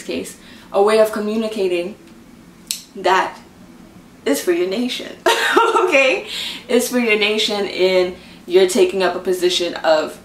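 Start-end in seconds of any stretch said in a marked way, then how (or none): 5.2-5.7 quick high burst of laughter; bright amused chuckle; short laugh. Real, not acted. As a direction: let a little laugh slip out. Amused aside to viewers.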